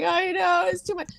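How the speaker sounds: high, weepy agreement; retold comic crying; mock sobbing. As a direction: agree in a high tearful whimper. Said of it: "I'm sobbing".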